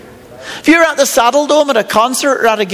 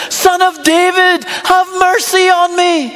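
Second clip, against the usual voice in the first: slow, higher-pitched call for mercy; louder retold yell; 6 semitones higher than usual. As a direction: cry out insistently, drawing the words out. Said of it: "He just yells louder".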